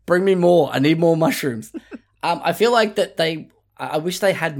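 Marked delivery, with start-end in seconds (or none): 0.1-1.7 lightly voiced needy character, higher and louder; loud, raised-pitch mock craving; demanding mushroom guy. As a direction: demand it like a hooked game character. Voicing the mushroom guy's demands.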